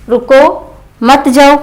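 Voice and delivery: रुको, break, मत जाओ as one unit; pause after रुको. Shown as a demonstration. Teacher showing where the mark falls, pause after रुको. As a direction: set रुको apart from मत जाओ. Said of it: "मना करने का भाव है".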